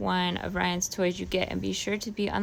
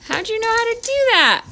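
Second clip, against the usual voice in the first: amazed; 12 semitones higher, 7 dB louder than usual. Real, not acted. Amazed at kid opening egg.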